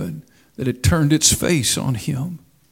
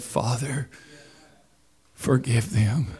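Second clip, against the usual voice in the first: much softer and lower, almost breathed; soft echo; 5 semitones lower, 10 dB quieter than usual. Retold or imitated. Preacher quietly repeating Jesus' plea to the congregation, hushed. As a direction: let it fall away tenderly.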